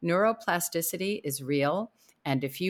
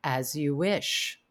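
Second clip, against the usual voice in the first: slow, lower, softened quote; feigned devotion; 5 semitones lower, 4 dB quieter, slower than usual. Retold or imitated. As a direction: imitate a husband's measured, drawn-out reply. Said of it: "pause for a second and go".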